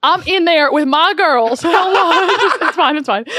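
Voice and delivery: hammed-up Southern storyteller; Southern accent. Telling a saloon story to cohosts in Southern accent. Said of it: "that was Southern".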